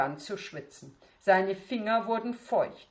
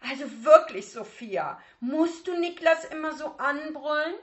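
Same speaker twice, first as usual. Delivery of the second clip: higher, louder reproachful mother; a mother scolding her daughter; 10 semitones higher, 7 dB louder than usual. Acted. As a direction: tadelnde Mutter.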